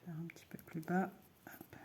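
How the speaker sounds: whispered stitch counting, almost no voice; whispering. Real, not acted. Crocheter counting stitches to herself, in a whisper. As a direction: breathe the count to yourself while working.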